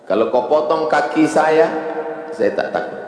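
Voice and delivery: high, loud, rising litany of fears; impassioned preaching. Acted. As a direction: hammer each fear home. Preacher lists fears, builds intensity.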